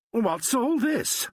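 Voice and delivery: high, slow, eager question; pitched well up and drawn out with nosy greed; prying Ackroyd, high and slow; greedily. A narrator's reading. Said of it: "asked Ackroyd greedily".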